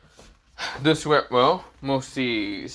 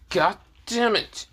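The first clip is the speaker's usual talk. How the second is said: acted high-pitched exasperated curse; pissed, annoyed; 8 semitones higher, slower than usual. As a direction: drag out the curse in mock annoyance. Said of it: "quite pissed and annoyed at him".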